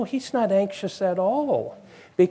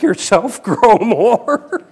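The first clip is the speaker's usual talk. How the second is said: laughing; 5 semitones higher, 5 dB louder, slower than usual. Real, not acted. Laughs mid-sentence.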